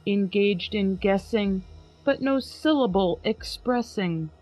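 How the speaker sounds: slow, grave recital of Poe; dramatic poem reading. Acted. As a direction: read it like a gothic poem by candlelight. Reads poem aloud.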